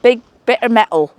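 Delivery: loud, playful mock Bristolian; Bristolian accent. Repeating the local phrase after a laugh, mock Bristolian accent. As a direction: punch it out in put-on Bristolian. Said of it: "Bristolian accent".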